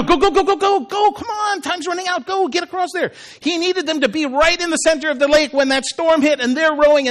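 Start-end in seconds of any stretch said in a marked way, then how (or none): 0.0-3.1 high, rushed go-go-go urging; urgent hurrying. Acted. Jesus hurrying disciples across the lake, urgently. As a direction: shoo them along in a high voice.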